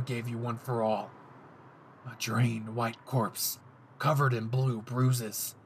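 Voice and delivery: low, slow gloating menace; cold possessive killer; threatening Bakugo. Acted. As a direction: savor every gruesome word.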